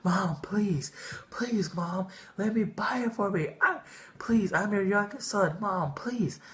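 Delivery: high, wailing little-kid begging, put on for effect; fake tantrum crying. Kid in Walmart begging mom for the Razr, wailing high. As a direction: beg like a nine-year-old throwing a fit to get the phone. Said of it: "I just start crying at the top of my lungs".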